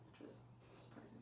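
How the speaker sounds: breathy deadpan whisper tossed off; whispered aside. Acted. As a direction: drop to a sly whisper for the punchline. Deadpan whispered aside after joke.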